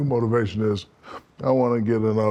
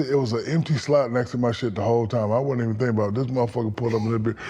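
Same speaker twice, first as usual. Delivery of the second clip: ordinary talk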